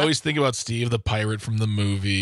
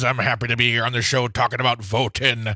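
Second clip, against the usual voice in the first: normal talking voice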